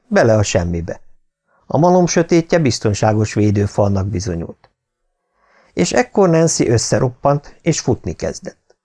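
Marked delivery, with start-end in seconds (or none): none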